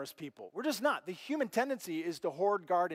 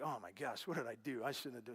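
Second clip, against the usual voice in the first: quiet, low second thoughts; mild grief; 6 semitones lower, 10 dB quieter than usual. Acted. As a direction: softly fret over a regretted act. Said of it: "What affects us is grief".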